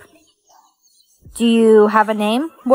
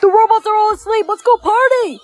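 much higher, fast and gleeful; excited whisper-shout; 10 semitones higher than usual. Acted. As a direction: sneak out thrilled to party. Human sneaks out excited.

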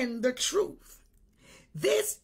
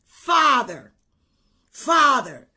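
loud, pleading calls of Father; mock fervent calling; 8 dB louder, slower than usual. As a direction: ham up a zealot crying out to the Father. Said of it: "they were very".

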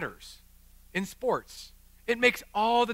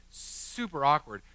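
normal talking voice

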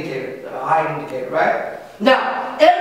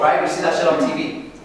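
ordinary talk, nothing marked

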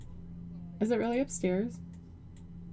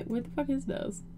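normal talking voice

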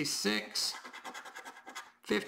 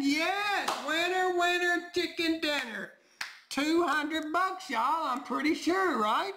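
excited; 7 semitones higher, slower than usual. Real, not acted. Telling viewers he won two hundred dollars, high and thrilled. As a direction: savor every word, thrilled and amazed.

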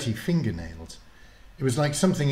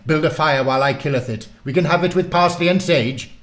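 fast, loud, higher commanding warlock; bossy warlock; 7 semitones higher, 9 dB louder than usual. Acted. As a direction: order her about briskly. Warlock orders girl.

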